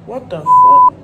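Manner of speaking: high, shrieking shock. Real, not acted. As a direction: scream out in disgust. Startled by something at breakfast.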